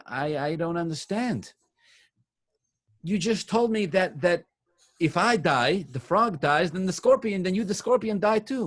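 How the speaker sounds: high-pitched voice of a puzzled frog; bewildered protest. Acted. Dying frog confronts the scorpion.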